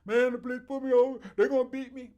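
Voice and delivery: high, loud mock complaint about police; imitating a worried driver. Mocking what others say when stopped by police, high and loud. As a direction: ham up the fearful guy pulled over.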